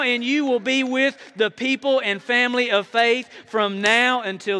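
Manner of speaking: loud, high, rising preacher's call; full-force preaching. Acted. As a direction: drive it home to the congregation.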